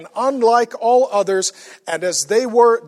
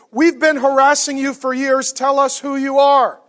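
demanding accusers; 6 semitones higher, 6 dB louder than usual. Acted. Voicing Jesus' accusers demanding answer.

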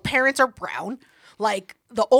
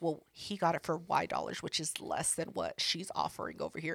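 hushed, much softer retold whisper; whispered aside; 6 semitones lower, 16 dB quieter than usual. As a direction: lean in and whisper it like a secret. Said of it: "whispers in my ear".

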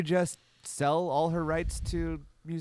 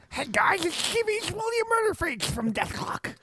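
high, strained cartoon metal bassist; William Murderface; 12 semitones higher than usual. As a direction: squeeze it up high like a cartoon rocker. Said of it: "I can do that and William Murderface".

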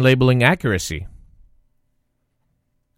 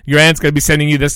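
usual speaking voice

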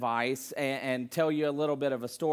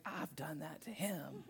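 inner thought; 15 dB quieter than usual. Retold or imitated. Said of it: "you're really thinking".

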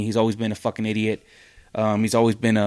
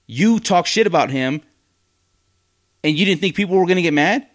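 higher, rising exasperation; fast, indignant outburst; exasperated rant; 6 semitones higher, 3 dB louder than usual. Real, not acted. Ranting at [name].

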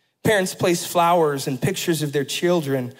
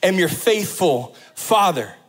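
full-force preaching; 5 semitones higher than usual. Acted. Pastor assuring the congregation of God's faithfulness, preaching hard. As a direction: press each promise home.

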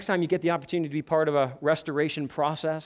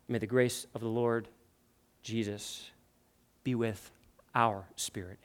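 closing prayer; 2 semitones lower than usual. Real, not acted. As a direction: pray gently, pausing between phrases.

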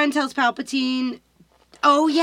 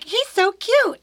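gushing; 8 semitones higher than usual. Retold or imitated. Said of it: "he's like".

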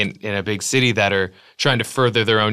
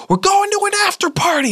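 high party-goer squeal. Retold or imitated. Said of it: "somebody goes".

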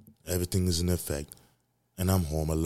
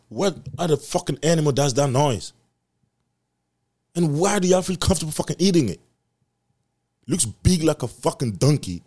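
disgusted; 8 semitones higher, 5 dB louder than usual. Real, not acted. Disgusted rant.

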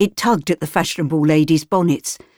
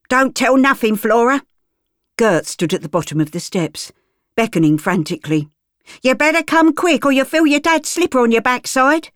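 higher, urgent threatening call; a harsh mother figure calling the child back; cockney woman; 7 semitones higher than usual. Acted. Gert threatens Flora from the steps, urgent.

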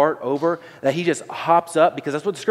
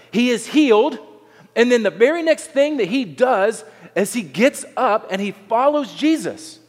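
ordinary talk

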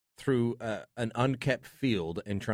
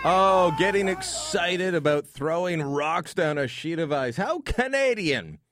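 mock excitement; 8 semitones higher, 5 dB louder, slower than usual. Host mocking curling promo excitement.

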